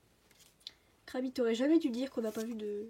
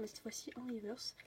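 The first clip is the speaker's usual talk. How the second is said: whispering; 14 dB quieter than usual. Real, not acted. Whispered aside while opening cards.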